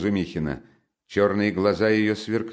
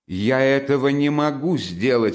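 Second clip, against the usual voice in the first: high, raised-voice indignation; offended cry; 7 semitones higher, 4 dB louder than usual. Acted. Offended girl answering her brother, indignant cry. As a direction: cry out, stung. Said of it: "вскричала обиженная девушка".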